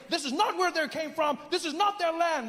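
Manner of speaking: high, emphatic mock indignation; imitating self-righteous anti-immigrant talk; confident complainer. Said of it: "People confidently saying".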